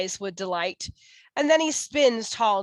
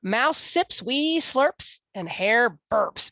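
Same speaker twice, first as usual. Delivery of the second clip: plain reading voice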